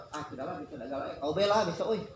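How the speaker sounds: a soft, giving-up sigh of a voice; half-hearted quiet resignation; mock defeated mumble. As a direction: mumble it like you've lost heart. Mimics a discouraged presenter giving up.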